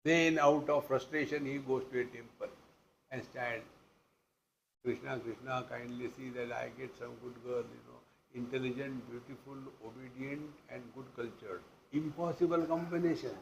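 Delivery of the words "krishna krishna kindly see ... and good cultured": quiet mock prayer. Young man praying at a temple.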